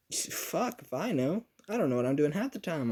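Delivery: high-pitched exasperated comeback; exasperated cluelessness. Retold or imitated. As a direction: throw it out high, clueless and defensive. Acted clueless reply to questioning.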